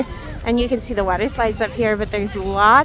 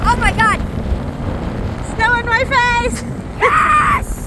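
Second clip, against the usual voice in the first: excited shouting; 9 semitones higher, slower than usual. Real, not acted. Riding tube downhill.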